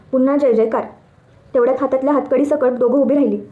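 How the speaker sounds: usual speaking voice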